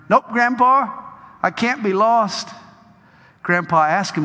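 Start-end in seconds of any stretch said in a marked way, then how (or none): 0.1-2.5 put-on high, cheeky little boy. Grandson assuring grandpa, high childlike voice. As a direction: grin through it like a confident kid.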